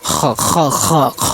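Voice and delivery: heavy খ, throat noise brought out; rasping throat sound. Shown as a demonstration. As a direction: repeat খ with audible back-throat scraping. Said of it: "এই শব্দটা করবেন আগে".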